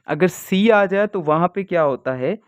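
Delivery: ordinary talk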